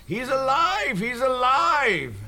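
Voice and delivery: mock shriek, pitched very high; high-pitched hammed-up yell of joy; retold shouting. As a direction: imitate her excited cry, high and drawn out. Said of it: "she's shouting at the top of her lungs".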